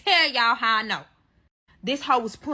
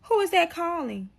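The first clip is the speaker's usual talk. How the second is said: higher, prying mock questions; lightly voiced nosy friend pestering; mimicking a nosy friend; 6 semitones higher than usual. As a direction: friend peeking over her shoulder, mock nosy voice. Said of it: "talking about some".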